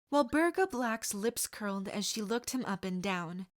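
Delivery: plain reading voice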